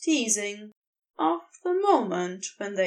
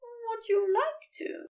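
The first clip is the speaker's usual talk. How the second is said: higher, soft, daring question; soft curiosity; 9 semitones higher, 4 dB quieter than usual. A narrator's reading. Angel asks Crowley, softly curious. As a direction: ask softly with a hint of daring. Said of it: "a soft curiosity to the question".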